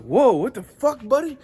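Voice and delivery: loud, high startled retort; acted alarm. Gym-goer to the yelling stranger, acted startled shout.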